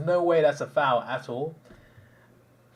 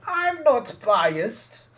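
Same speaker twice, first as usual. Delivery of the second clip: very high, drawn-out mimicry; hammed-up imitation of an indignant protest; mocking denial; 12 semitones higher, 5 dB louder, much slower than usual. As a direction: stretch it out in a high, loud put-on voice. Mimicking the man's heated denial.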